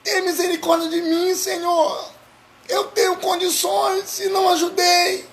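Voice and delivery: high, pleading shout to the Lord; pleading cry for mercy. Retold or imitated. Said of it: "alguém dizia".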